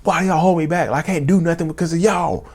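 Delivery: high-pitched blaming rant; accusing, pitch held way up; retold yelling. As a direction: reenact an angry tirade, moderate volume. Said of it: "Screaming and yelling at my son".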